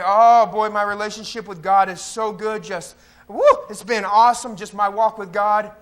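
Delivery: loud, hammed-up spiritual bragging; mock pious gushing. Churchgoer bragging about their walk with God, mock gushing. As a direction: gush loudly about how wonderful it all is.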